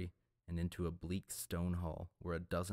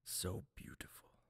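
awed whisper; 7 dB quieter than usual. A narrator's reading. Broken priest whispering at the sunlight, awed whisper. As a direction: whisper it in awe at the light.

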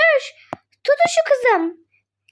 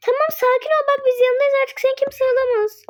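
ordinary talk

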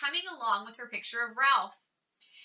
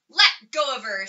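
louder angry demand; 8 dB louder than usual. A narrator's reading. Beezus angrily tells Wayne to let go of Ramona. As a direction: make him let go of your sister. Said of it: "said Beezus angrily".

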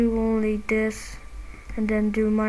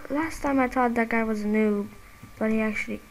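usual speaking voice

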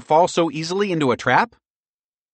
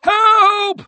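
very high, loud squeal; desperate high-pitched cry; crying for help; 7 dB louder than usual. A narrator's reading. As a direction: shriek it out for rescue. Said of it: "Merle cried".

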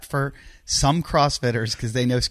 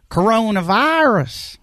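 high, slow twangy drawl on "Coronavirus"; Holly Hunter imitation; 10 semitones higher, 3 dB louder, slower than usual. Dad imitating his daughter's drawl for his friend, high and slow. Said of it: "she says it like Holly Hunter".

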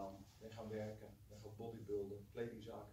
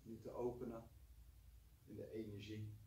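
guided meditation. Acted. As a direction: gently guide them to relax. Leads a short meditation.

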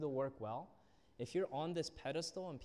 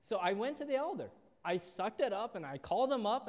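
louder, higher, animated storytelling; swept up in the retelling; 7 semitones higher, 7 dB louder than usual. Real, not acted. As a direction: tell it like the punchline's coming. Retelling, laughing at the mentor's advice.

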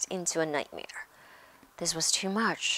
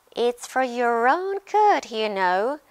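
high, loud mock-virtuous tone; saintly voice; 5 semitones higher, 8 dB louder than usual. A narrator's reading. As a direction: feign concern as a preachy imp. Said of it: "said Peeves in a saintly voice".

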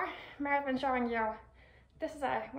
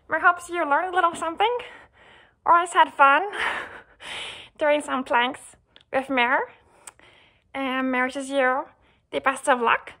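cheerful loud goodbye; 12 dB louder than usual. Real, not acted.

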